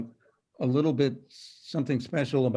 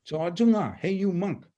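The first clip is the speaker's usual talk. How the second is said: higher-pitched, dramatic abrupt hail; 6 semitones higher, 3 dB louder, much faster than usual. Acted. Calling out to a monk in a poem, abrupt. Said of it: "it's very abrupt".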